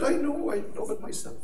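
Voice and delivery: high-pitched, hammed-up Irish reply; exaggerated Irish accent. Irishman answering praise of his accent, exaggerated Irish accent. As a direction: play up the Irish charm. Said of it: "turn my Irish accent up to eleven".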